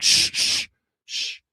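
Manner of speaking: sharp drawn-out shushes; imitated church hush. Churchgoers silencing a visitor, mock shushing. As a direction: shush like a stern congregation. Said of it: "they're like".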